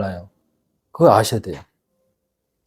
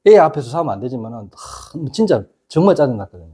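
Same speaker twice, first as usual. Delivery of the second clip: ordinary talk, nothing marked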